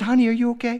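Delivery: put-on a touch of alarm, checking on a child; re-enacted fatherly concern; worried dad. Worried father to crying son.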